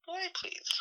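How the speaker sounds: high, whispered scoff; dismissive whisper. Retold or imitated. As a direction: brush him off under your breath. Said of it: "I'm like".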